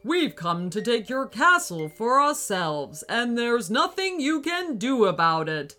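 booming robot. Acted. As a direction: boom like a giant evil robot. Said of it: "boomed the giant robot".

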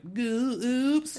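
high squeaky little oops; mock oops. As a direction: play a small slip-up. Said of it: "kind of like".

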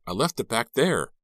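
high, loud exclamation of dismay; alarmed cry. A narrator's reading. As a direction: blurt it out in alarm. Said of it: "Ricky cried".

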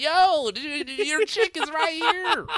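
high, loud teasing; imitating himself loudly ribbing the biker; mock stage taunt. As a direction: call him out in front of everyone. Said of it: "I was like".

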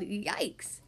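low, small scared yikes. A narrator's reading. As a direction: gulp it out in fear. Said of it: "Froggy yelled".

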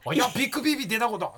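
quick, lightly voiced Akita dialect; mock Akita lady. The host mimics the hospital nurse in Akita dialect, quick and light. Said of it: "なんて".

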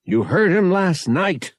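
mild shout, pitched well up. A narrator's reading. Pavak arguing with mother and Bria, mild shouting. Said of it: "Pavak shouted".

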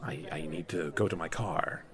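low, very soft, halting voice; hesitant low murmur with long pauses; reticent patron. Acted. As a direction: withdraw politely, voice dropped low and quiet.

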